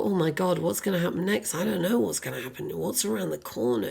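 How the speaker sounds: hushed, quick worried fretting; understated dread of what comes next; mild fear. Acted. Imagining fear of what's around the corner, mild hushed worry. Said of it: "Or potential fear of".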